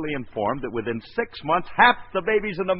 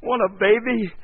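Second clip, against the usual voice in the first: higher and louder, bursting with joy; overjoyed; 7 semitones higher, 4 dB louder than usual. Real, not acted. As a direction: babble with delight. Joy at baby news.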